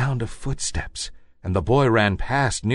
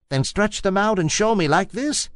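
a hint of a lively, higher wild rabbit; lightly voiced wild rabbit; 10 semitones higher than usual. A narrator's reading. Wild rabbit daring the toy rabbit, lightly voiced. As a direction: tease like a bouncy wild bunny. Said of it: "said the wild rabbit".

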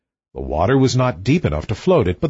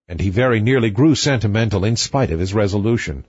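plain reading voice

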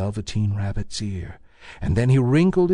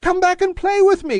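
high, louder calling rabbit voice; little rabbit calling; 5 dB louder than usual. A narrator's reading. Toy rabbit calling after the vanished rabbits, high call. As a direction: call after them like a lonely toy bunny. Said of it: "called the little rabbit".

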